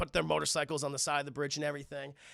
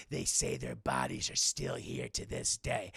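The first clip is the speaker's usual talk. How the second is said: much lower, slow ghost-story voice; spooky storyteller; 3 dB quieter than usual. Retold or imitated. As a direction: drop into a creepy campfire tone. Spooky end of ghost tale.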